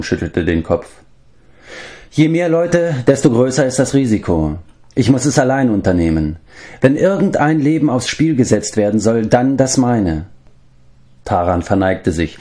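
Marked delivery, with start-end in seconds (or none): 2.1-10.3 lightly voiced leader, higher than narration; firm, brooking no contradiction; Gwydion. A narrator's reading. Leader refuses companions to go alone, lightly voiced firmness.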